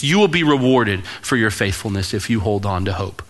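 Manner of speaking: ordinary talk, nothing marked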